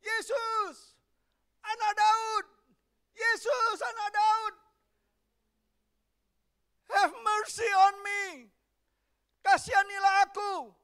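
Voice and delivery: high, loud desperate cry for mercy. Acted. Blind Bartimeus shouting to Jesus, high and loud begging. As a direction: call out over a crowd, begging. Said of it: "Teriak-teriak dia".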